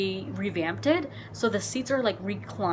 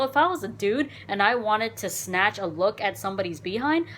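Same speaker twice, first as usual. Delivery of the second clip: usual speaking voice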